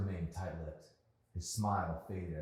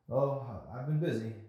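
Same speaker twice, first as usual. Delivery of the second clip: put-on nervous young man; 7 semitones higher, 7 dB louder than usual. Nervous boy answering crush.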